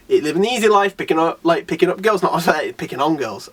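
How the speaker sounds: usual speaking voice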